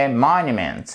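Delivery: "Monument" with a strong MON and falling tail; teacher's model of "Monument", stress at the start; stress on first syllable. Shown as a demonstration. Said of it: "l'accent tonique".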